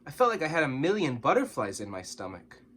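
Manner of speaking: ordinary delivery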